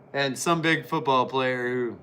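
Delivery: normal talking voice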